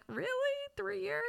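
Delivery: high, quiet, questioning disbelief; incredulous little squeak of doubt; mock disbelief. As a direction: query it softly, pitch climbing high. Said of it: "I'm like".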